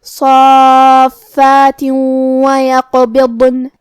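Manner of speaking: صافات وَيَقْبِضْنَ chanted very slowly, tanween merged into a doubled, hummed waw; slow tajweed demo of صافات وَيَقْبِضْنَ with nasal merging; ghunnah and tashdid. Shown as a demonstration. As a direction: run صافات into وَيَقْبِضْنَ through a held nasal w. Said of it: "تنوین کو واو میں ملا کے غنہ اور تشدید کے ساتھ".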